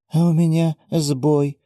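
put-on slow, sad old woman voice. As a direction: sigh it out like a sad granny. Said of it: "грустно произнесла Саймин".

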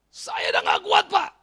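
high, loud cry of someone giving up; mock desperate shout. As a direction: imitate a counselee shouting they can't cope. Retold counselee crying out in despair. (teriakan putus asa yang ditirukan)

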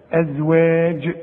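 slow "ازواج" with final "j" as in judge; soft affricate jeem. Shown as a demonstration. Teacher demonstrating the letter, slow soft jeem with bounce. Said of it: "تعطش".

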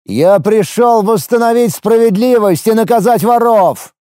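high, loud, defiant declaration; defiant shout. A narrator's reading. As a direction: proclaim it defiantly. He answers the elder.

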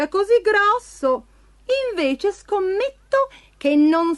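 high-pitched, crafty cat voice; cat calling out tauntingly. A narrator's reading. Cat calls from behind a chimney to goad ogre, high teasing voice. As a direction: call out high, then dangle the dare. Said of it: "Il gatto si nascose dietro un comignolo e gridò".

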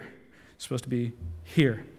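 low, hushed, with a pause before "here"; quiet self-correction. Acted. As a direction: drop right down and place "here" softly. Corrects himself, points elsewhere.

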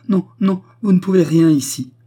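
high, loud, distressed rebuff; agitated refusal. A narrator's reading. Widow rejecting her hostess's sympathy, agitated. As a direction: cut off her comfort vehemently.

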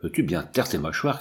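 ordinary delivery, nothing marked

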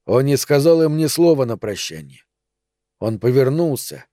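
ordinary delivery

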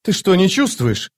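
put-on high-pitched girlish disbelief; alarmed young woman. A narrator's reading. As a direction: ask urgently in a high girl's voice. Alarmed girl questions the captain. (высокий взволнованный девичий голос)

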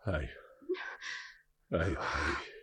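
low, hushed, halting; soft confession. Acted. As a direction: confide his fear in a near whisper. Confessing softly to beloved. (اعتراف بصوت واطي)

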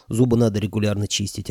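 ordinary delivery

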